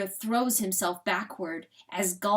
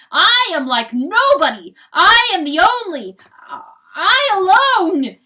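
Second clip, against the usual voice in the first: high, loud, wailing shouts; anguished proclamation; 10 semitones higher, 15 dB louder than usual. Acted. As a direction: cry it out, stretching the last words. Rooster proclaims himself unlike anyone.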